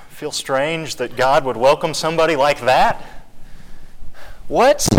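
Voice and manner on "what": high-pitched mock disbelief; sharply raised, incredulous question; incredulous outburst. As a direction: blurt it out in acted disbelief. Acts out an offended believer objecting.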